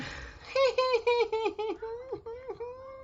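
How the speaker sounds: long high giggle; high laugh. Real, not acted. Laughs after singing.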